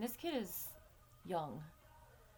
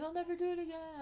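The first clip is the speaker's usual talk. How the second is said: put-on kid's promise; 10 semitones higher, faster than usual. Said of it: "he was like".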